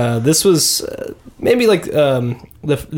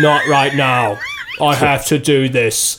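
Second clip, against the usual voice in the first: slow, shouted mock American accent of a British actor; bad American accent, shouted. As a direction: drag out "Not right now" and shout it in a clumsy fake American accent. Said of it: "He sounded like".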